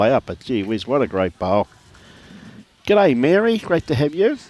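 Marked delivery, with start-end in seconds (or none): 2.9-4.4 high, bright hello to a listener; cheery on-air greeting. Real, not acted. Commentator greeting a listener, high and louder. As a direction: call out a happy g'day.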